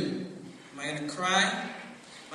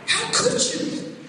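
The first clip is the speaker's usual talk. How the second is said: high, loud, slow mock outrage; reproachful crowd; 9 dB louder, slower than usual. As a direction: scold the bully with exaggerated shock. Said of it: "they're looking at the guy like".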